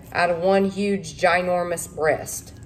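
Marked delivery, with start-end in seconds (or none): none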